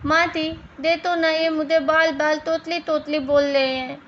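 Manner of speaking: lisped complaint, "माँ जी" said as "माँ दी"; lisping. Acted. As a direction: turn j into d in "माँ दी, दे तो", like a tongue-tied child. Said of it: "Nimita की तोतली आवाज़". (तुतलाकर "माँ दी" कहते हुए शिकायत)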